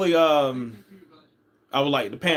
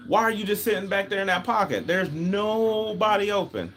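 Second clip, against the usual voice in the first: high, exasperated scolding of a passive quarterback; pitched up, disbelieving, pleading with him to move; 7 semitones higher than usual. Retold or imitated. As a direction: lecture him like a frustrated fan yelling at the TV. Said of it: "I'm like, it's like".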